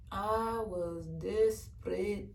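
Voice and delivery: desperate drawn out slowly, word by word; slow and weighty confession; slow, heavy repeat. Real, not acted. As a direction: let "I was desperate" sink in, slowly. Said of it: "I'm not gonna lie".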